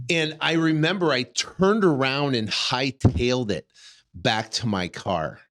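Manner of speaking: ordinary delivery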